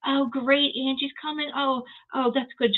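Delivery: imitating Lisa's fake cheer; high mock-sweet snark; mock sarcastic Lisa. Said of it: "Lisa goes".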